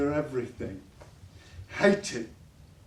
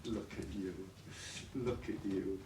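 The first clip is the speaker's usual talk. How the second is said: soft taunt; 8 semitones lower, 12 dB quieter than usual. Acted. Father quietly taunts upset daughter.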